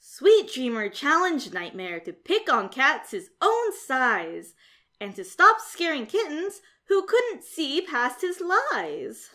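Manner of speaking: ordinary delivery, nothing marked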